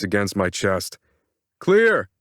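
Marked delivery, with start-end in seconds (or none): 1.6-2.1 loud, sharp command shout; yelling. A narrator's reading. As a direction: call it hard and urgent. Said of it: "the doctor says again".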